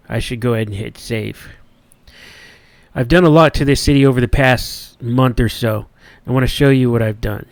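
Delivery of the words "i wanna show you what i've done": ordinary talk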